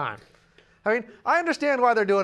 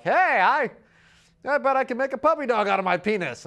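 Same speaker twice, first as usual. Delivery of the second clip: a loud, tipsy boast, a bit higher; drunk bar boast; 5 semitones higher, 4 dB louder than usual. Retold or imitated. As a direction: brag like a drunk guy at the bar. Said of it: "and they were like".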